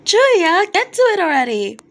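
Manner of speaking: high, loud shout from the crowd; impatient fan scream. Acted. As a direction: yell it across the hall, fed up. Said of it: "Someone from front row screamed out".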